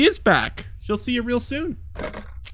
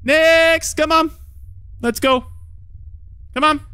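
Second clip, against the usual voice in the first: calling out; 9 semitones higher than usual. Acted. Calling next visitor in.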